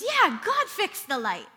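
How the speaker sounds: put-on high, loud toddler voice, drawn out; acted small-child enthusiasm; Keegan. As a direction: imitate an eager little boy agreeing. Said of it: "So Keegan goes".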